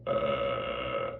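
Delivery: low creaky death rattle. Shown as a demonstration. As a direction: croak it from deep in the throat. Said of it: "something between a death rattle and a barista".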